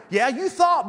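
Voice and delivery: ordinary delivery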